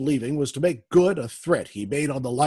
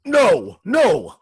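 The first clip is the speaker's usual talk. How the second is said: higher and louder, a frantic no; panicked protest; 7 semitones higher, 7 dB louder than usual. Acted. Warden panics as the Shadow names his scheme, frantic protest. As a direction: deny it in a rising panic. Warden accused of plotting murders.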